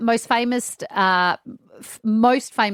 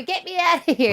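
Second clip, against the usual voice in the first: high pleading cry to be let down; hammed-up panic, pitched way up; mock scared plea; 9 semitones higher than usual. Scared contestant begging the hosts to get her down, mock panic. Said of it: "and I'd go".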